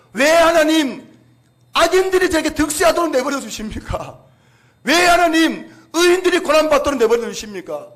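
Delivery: loud, high, accusing cry at God; shouting why at God; indignant complaint. Retold or imitated. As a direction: voice Habakkuk's bitter protest, loud and high. Preacher voices Habakkuk's complaint.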